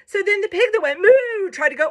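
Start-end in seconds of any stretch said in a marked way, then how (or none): 1.0-1.5 high, loud moo; pig's moo. Acted. Reader voicing the pig who moos, loud high moo. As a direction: moo loudly, pitched right up. Pig in story tries mooing.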